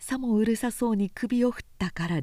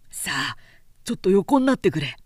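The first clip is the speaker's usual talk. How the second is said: firm stop; 6 dB louder, faster than usual. A narrator's reading. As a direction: stop her drinking, firmly. Man urging her to lie down.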